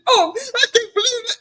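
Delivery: high-pitched mock sobbing with a laugh; mock crying. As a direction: wail it as a hammed-up cry. Mocking a frustrated, wailing cry.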